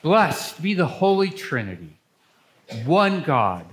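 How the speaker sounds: ordinary talk, nothing marked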